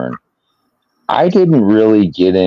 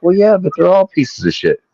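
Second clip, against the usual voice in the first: much higher, mock-casual brush-off; husband brushing off his wife's complaint; dismissive retort; 13 semitones higher than usual. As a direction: shrug it off, half-teasing. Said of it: "I'm like".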